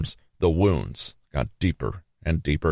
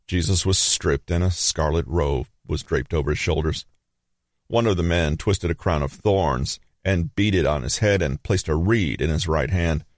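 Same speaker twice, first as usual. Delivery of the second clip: ordinary delivery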